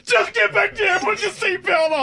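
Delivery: high, loud frantic pilot; panicked pilot shout. Retold or imitated. Pilot yelling at a passenger to sit down. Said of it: "and he was like".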